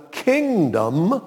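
kingdom drawn out with a rising lift; emphasis on kingdom. Shown as a demonstration. Preacher stressing kingdom to the congregation, drawn out and rising. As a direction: lean on kingdom, stretch it and let it rise. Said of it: "Please notice my emphasis on the word kingdom".

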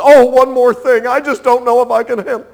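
loud, high, overburdened complaint; mock straining. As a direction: ham up being overwhelmed. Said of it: "It's not like God strains".

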